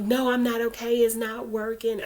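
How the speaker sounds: loud frustration just short of yelling; frustrated outburst. Retold or imitated. Telling her sister it's not working, frustrated. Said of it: "I didn't yell at her".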